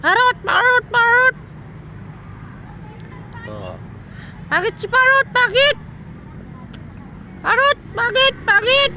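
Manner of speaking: very high squeaky coaxing call; high pet-talk voice; high bird-talk. Real, not acted. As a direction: sing it up high to coax a bird. Calling the parrot's name.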